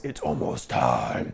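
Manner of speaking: very deep, slow, hushed demon; slow demonic growl, far below normal pitch. A narrator's reading. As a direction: drag it out low and menacing like a demon. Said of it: "tells her in a demonic voice".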